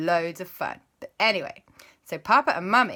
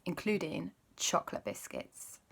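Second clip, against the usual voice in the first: ordinary delivery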